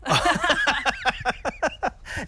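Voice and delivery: high burst of real laughter. Real, not acted. Host amused by the Brooklyn joke, laughing. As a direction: laugh helplessly for a couple of seconds.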